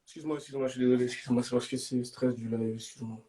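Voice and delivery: soft sheepish apology to Mélodie. Real, not acted. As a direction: back down softly. Apologizing after being told off.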